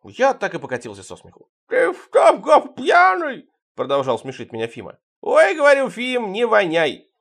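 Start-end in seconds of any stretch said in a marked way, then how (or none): 1.7-3.5 very high, slow, loud lisp; squeaky toothless drunk; high lisping voice of a beaten friend; lisping Fima. Acted. 5.2-7.1 much higher, slow and louder; high drawn-out teasing protest; high mock complaint. Narrator's own quote, high.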